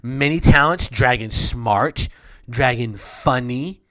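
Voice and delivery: put-on loud, childlike queen voice, proud of her dragon; simple dwarf queen. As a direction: boast like a dim little queen, loud.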